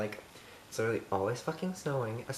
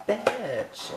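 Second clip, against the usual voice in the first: bitch sung high and affectionate, falling; loving bitch; 9 semitones higher, 4 dB louder than usual. Shown as a demonstration. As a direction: say it like a fond greeting to a friend. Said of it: "If you love someone".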